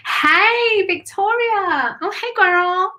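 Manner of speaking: high, delighted hello to a friend; excited greeting. Real, not acted. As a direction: sing out the welcome. Greeting a viewer joining live.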